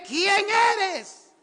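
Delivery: high, full-force shout; preacher roaring a quoted question. Retold or imitated. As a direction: cry it out at the top of your voice. Shouts Saul's question from the story.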